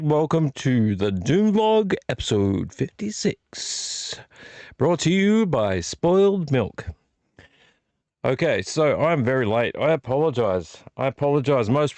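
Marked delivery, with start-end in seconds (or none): 0.0-6.9 slow, hammed-up announcer read; show intro. Host announcing the episode and fake sponsor, slow announcer style.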